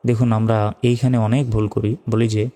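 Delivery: usual speaking voice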